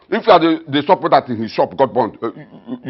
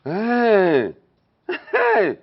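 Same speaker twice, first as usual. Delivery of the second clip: mock surprise; 5 semitones higher than usual. Father mocks the news.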